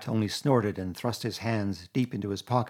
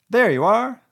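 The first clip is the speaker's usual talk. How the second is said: much higher and louder triumphant cry; cried out; 11 semitones higher, 11 dB louder than usual. A narrator's reading. Toad unveiling the caravan to friends, loud cry. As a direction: shout it like a showman. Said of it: "cried the Toad".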